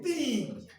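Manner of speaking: put-on "teen" drawn out long on a high falling pitch; long-vowel teen. Shown as a demonstration. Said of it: "Not teen".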